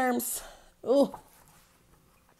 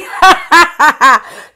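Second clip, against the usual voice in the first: loud high laugh for the class; a big show laugh; 4 semitones higher, 14 dB louder than usual. Shown as a demonstration. Teacher showing kids a laugh, loud laughing. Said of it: "means it's funny".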